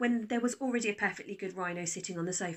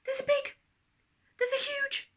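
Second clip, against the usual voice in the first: put-on high, loud flustered little girl; child voice; 6 dB louder than usual. A narrator's reading. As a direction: stumble over the words in a rush. Daisy urgently telling Dad.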